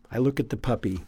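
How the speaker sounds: usual speaking voice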